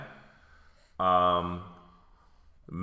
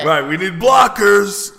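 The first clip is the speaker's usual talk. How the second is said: loud hammed-up coach call; coach shout; 9 semitones higher, 8 dB louder than usual. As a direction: bark it like a coach. Coach voice continues.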